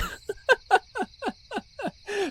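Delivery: squeaky high-pitched giggle; high laugh. Acted. Laughing off the dream of pitching a script, high giggle. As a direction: burst into a helpless high laugh.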